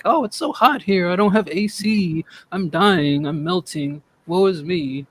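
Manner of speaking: high-pitched mock complaining; Bird impression. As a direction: whine theatrically as if suffering badly in the heat. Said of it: "It's my best Bird impression".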